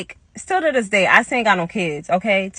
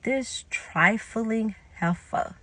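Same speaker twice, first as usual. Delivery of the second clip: retold disgust; 8 dB quieter, much slower than usual. Teller thinking about Diana, slow quiet disgust. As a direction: draw the insult out softly.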